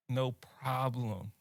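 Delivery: drawn-out, slow "No problem, I got that"; slow easy assurance. Retold or imitated. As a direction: play it cool and generous, slowly. Retelling his generous reply.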